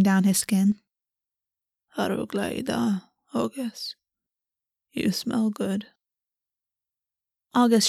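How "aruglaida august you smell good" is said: softer, hushed prince close to his lover; lightly voiced prince, quiet and close; hushed Raven Prince. A narrator's reading. Raven Prince speaks Welsh intimately.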